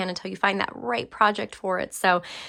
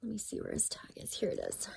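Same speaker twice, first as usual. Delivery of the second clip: whispering; 2 semitones higher, 17 dB quieter than usual. Real, not acted. Searching for tag, whispering aside.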